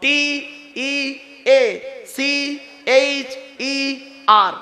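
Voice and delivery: drawn-out letter by letter; slow spelled-out letters. Shown as a demonstration. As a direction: spell each letter slowly and deliberately. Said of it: "teacher হতে গেলে কতগুলো গুণ থাকতে হবে".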